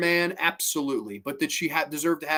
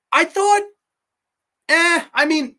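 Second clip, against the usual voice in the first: high-pitched, louder hedging; skeptical; 14 semitones higher, 6 dB louder than usual. Real, not acted. Reviewer unsure about the reconciliation scene, high skeptical hedge. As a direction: wince out the doubt in a high voice.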